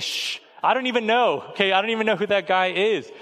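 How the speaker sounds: little daughter. Retold or imitated.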